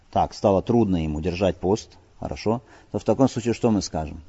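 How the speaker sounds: ordinary delivery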